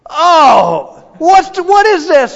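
loud, high-pitched exasperated cry; frustrated outburst. Retold or imitated. Frustrated customer reading the wrong receipt, exasperated outburst. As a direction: burst out in retold disbelief. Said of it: "and I'm like".

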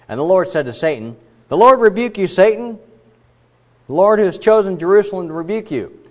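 put-on declaimed Old Testament passage; scripture reading. A narrator's reading. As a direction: read scripture aloud to a congregation, lifting the spoken lines. Reads the Bible passage aloud.

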